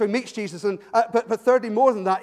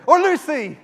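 sudden shout; 8 semitones higher, 11 dB louder than usual. Shown as a demonstration. Preacher shouts another name at congregation, loud call. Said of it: "if I suddenly shouted out".

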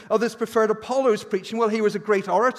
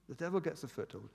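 the same words repeated much softer; hushed echo; 13 dB quieter, faster than usual. Shown as a demonstration. Preacher repeating the warning, softly and gravely. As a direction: drop to a near hush on the repeat.

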